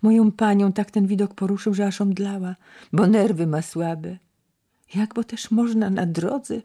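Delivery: a hint of a fussy maid; servant girl. A narrator's reading. Maid explaining her lady fainted, lightly voiced servant.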